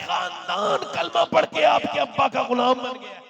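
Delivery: grateful speech building to a high, loud climax; impassioned sermon cry, rising in pitch; fervent preaching. Acted. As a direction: swell from steady praise into full-force proclamation. Preaches a convert's grateful words.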